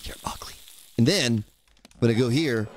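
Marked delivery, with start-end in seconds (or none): 0.0-0.5 whisper. Real, not acted. Muttering aside at the screen.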